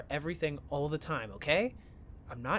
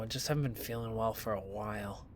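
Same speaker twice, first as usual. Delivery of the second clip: low-pitched, ailing voice; unwell; 6 semitones lower than usual. Acted. Sick student confides in friend, unwell. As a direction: sound drained and sick.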